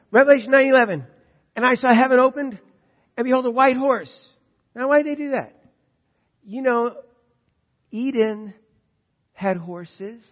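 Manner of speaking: loud, high, ringing sermon voice; full-force preaching. Acted. Preacher proclaiming scripture to the congregation, full force. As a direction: proclaim it to the whole hall.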